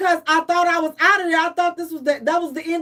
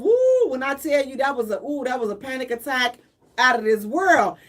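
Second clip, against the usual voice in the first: ordinary talk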